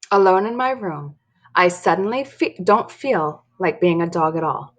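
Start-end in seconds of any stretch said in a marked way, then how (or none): none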